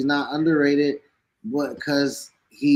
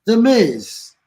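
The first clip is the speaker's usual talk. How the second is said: excited announcement; 6 semitones higher, 6 dB louder, slower than usual. Real, not acted. Announces his number five pick.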